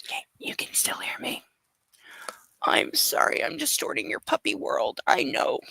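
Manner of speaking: very soft, whispered puppy talk; apologetic whisper. Real, not acted. Mommy apologizing to her puppy, whispered.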